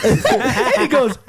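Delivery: words pushed out through a laugh; cracking up mid-sentence. Real, not acted. Telling friends the bra-note story, laughing through it.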